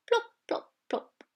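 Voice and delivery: tip-toe the plops; whispered plops. A narrator's reading. Narrator whispers walruses plopping in water.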